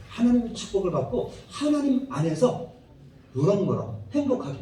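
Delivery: normal talking voice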